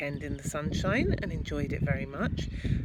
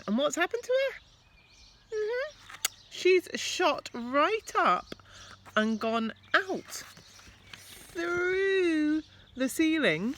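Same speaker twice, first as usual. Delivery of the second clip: excited storytelling; 13 semitones higher, 4 dB louder than usual. A narrator's reading. Dramatic storytelling to children.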